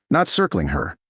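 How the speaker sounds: ordinary delivery, nothing marked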